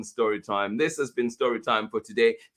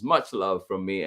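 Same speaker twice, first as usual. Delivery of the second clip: ordinary talk, nothing marked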